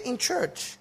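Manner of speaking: high, incredulous call-and-response; repeat in shock. Shown as a demonstration. Pastor to congregation, high disbelieving repeat. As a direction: make them echo the disbelief.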